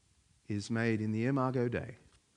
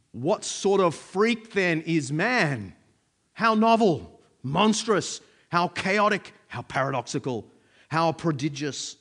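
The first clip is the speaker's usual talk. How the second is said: declaimed quotation; 2 semitones higher, 5 dB louder, slower than usual. Acted. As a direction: proclaim each exclamation as its own burst, easing off at the end. Reads a Pascal quotation aloud.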